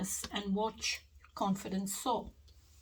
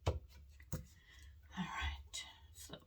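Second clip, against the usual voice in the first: whispering; 9 dB quieter, slower than usual. Real, not acted. Whispering to Spirit while shuffling.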